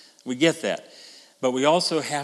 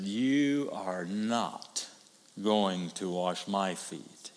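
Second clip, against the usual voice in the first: very slow, lower and softer, each word weighed; stubborn refusal; 9 semitones lower, 8 dB quieter, much slower than usual. Acted. Peter refusing Jesus.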